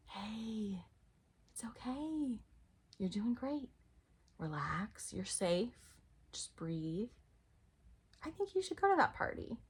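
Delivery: soft, hushed reassurance; calm them down softly; gentle comfort. Acted. Higher self's comforting voice.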